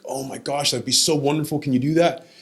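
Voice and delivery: high, imitated excitement; retold delight. Grandfather thrilled he will see the pictures, retold excitement.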